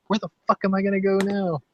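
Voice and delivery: high-pitched, dismayed question; imitated girlfriend's panic. Imitating the girlfriend after her dad came by, high mock panic.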